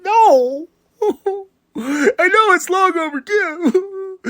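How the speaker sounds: high, loud disbelieving no, then slow laughter; giddy, slow, high laugh-cry of surprise; shocked laughing no. Real, not acted. Shock at the new champion.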